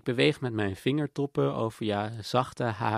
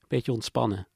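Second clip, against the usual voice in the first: usual speaking voice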